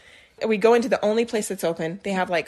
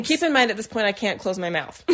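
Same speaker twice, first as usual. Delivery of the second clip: ordinary talk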